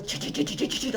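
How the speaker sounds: rapid-fire chattering helicopter blade sound; imitated blade noise. Helicopter blade cuts into the car roof, imitated chattering noise. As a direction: chop out a quick stuttering "ch" like cutting blades. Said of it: "I could hear it really loud".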